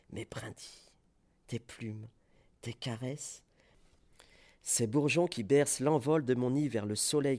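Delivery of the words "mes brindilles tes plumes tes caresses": whispered caress. Acted. Intimate fading.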